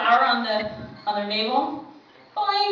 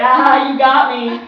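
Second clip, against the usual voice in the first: laughing; 8 dB louder, slower than usual. Retold or imitated. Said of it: "And I start laughing".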